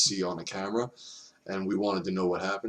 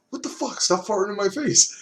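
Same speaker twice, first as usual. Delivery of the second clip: mock outraged biker; 12 semitones higher, 4 dB louder than usual.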